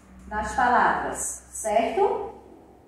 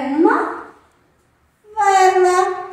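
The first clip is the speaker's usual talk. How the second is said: VELA loud and high; raised, ringing VELA to delight the children; excited reveal; 7 semitones higher, 7 dB louder, slower than usual. Shown as a demonstration. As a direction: announce VELA like the big surprise. Revealing last object from box.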